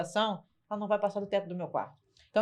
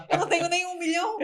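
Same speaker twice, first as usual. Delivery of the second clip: high, louder cry of disbelief; exasperated exclamation; 9 semitones higher, 5 dB louder than usual. Retold or imitated. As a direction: throw up your hands at the price. Retold objection continues. (exclamação exasperada, mais aguda)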